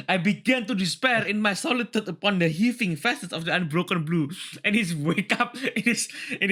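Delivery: high, hammed-up dramatic reading; mock dramatic reading. A narrator's reading. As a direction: read it like a gloomy narrator, overdone. Reads the story aloud with a put-on voice.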